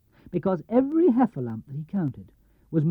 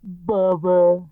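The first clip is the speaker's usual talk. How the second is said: louder Pooh grumble; Pooh in jar; 5 dB louder than usual. Acted. Pooh stuck in the honey jar, loud grumble. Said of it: "he said, inside the jar".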